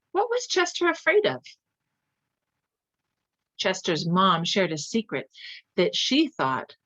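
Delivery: put-on gentle teacher questions to young listeners; asking children. Reader quizzing young listeners after the story, gentle teacher voice. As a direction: ask the children warmly.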